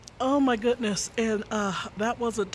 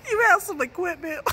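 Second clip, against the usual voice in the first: laughing; 6 semitones higher than usual. Real, not acted. Laughing telling embarrassing story.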